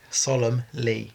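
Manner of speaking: "Solemnly" said slowly, every syllable clear, as SOL-em-lee; slow pronunciation demo. Shown as a demonstration. Coaching a stumbling co-star, saying the word slowly. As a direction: carefully spell out "Solemnly" for him. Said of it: "Don't pronounce the N".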